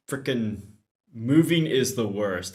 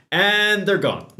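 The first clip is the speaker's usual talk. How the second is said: amused exclaim; 9 semitones higher, 9 dB louder than usual. Real, not acted. Daughter grabs cookies and runs.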